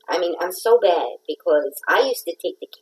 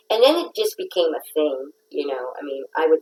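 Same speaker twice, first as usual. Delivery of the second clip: ordinary talk, nothing marked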